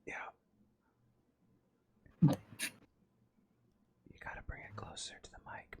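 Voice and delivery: ordinary delivery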